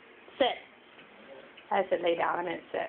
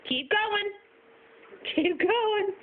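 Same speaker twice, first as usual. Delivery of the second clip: talking to a dog; 10 semitones higher, slower than usual. Real, not acted. Urging dog on.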